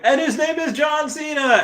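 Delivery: very high, hammed-up announcer shout; mock ring announcer calling John Cena. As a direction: belt it like a wrestling intro.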